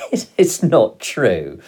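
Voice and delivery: loud, drawn-out, giggly disbelief; starting to laugh. A narrator's reading. Boy doubting his father's tall tale, starting to laugh. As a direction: break into giggles while protesting. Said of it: "starting to laugh".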